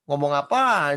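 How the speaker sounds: put-on a father catching his child dodging; stern father. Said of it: "Bapaknya bilang".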